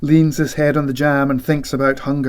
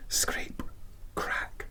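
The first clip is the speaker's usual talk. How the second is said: soft unvoiced scrape and crack; whispered scraping; 16 dB quieter than usual. A narrator's reading. Narrator whispering the rat's scraping sounds. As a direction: whisper the noises at the door. Rat noises voiced in story.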